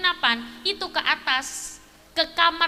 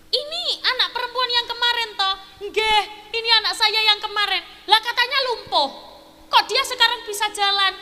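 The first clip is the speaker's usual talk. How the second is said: higher amazed questions; amazed questioning; 5 semitones higher than usual. Retold or imitated. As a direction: ask in disbelief. Thug amazed, questioning father.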